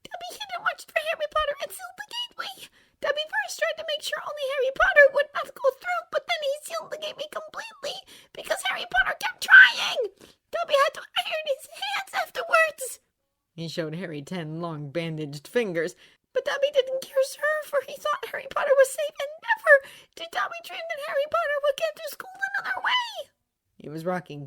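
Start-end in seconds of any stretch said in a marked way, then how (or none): 0.0-13.0 very high, whispery house-elf squeak; a tiny frantic elf pleading; Dobby voice. A narrator's reading. Tearful house elf begging Harry Potter, high and whispery. 16.3-23.3 very high, whispery house-elf squeak; a tiny frantic elf pleading; Dobby voice. A narrator's reading. Tearful house elf begging Harry Potter, high and whispery.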